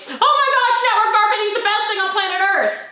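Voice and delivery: high, loud burst of mock hype; over-excited hype. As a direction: gush like a jumping, thrilled promoter. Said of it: "jump up and down and say".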